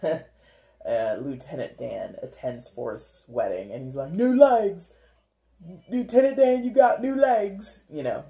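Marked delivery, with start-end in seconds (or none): 4.1-7.8 high, loud, excited movie-character impression; Forrest Gump. Quoting Forrest greeting Lieutenant Dan at his wedding, loud excited impression. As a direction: exclaim it in a raised, put-on voice. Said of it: "a visual comes to my head of Forrest Gump".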